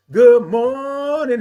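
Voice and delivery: loud, high, slow drawn-out MORNING; big held sing-out good morning; signature good morning. Pretend. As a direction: belt the greeting and stretch the vowel. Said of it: "that's how I say it".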